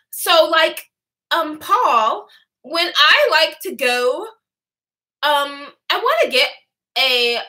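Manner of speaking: mock Louisiana Valley-girl impression; Valley-girl Louisiana accent. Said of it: "off-brand version plus a Valley girl".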